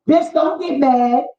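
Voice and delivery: usual speaking voice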